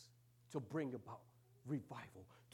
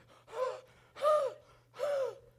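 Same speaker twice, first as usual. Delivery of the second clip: high, loud gasp for air; exhausted gasp; 14 dB louder than usual. Retold or imitated. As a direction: gasp like a runner out of breath. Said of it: "and I'm like".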